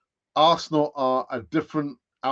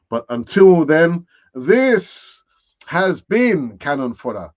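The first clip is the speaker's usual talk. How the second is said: show sign-off; 7 dB louder, slower than usual. Real, not acted. Host closing the channel's show for Arsenal fans, announcer sign-off.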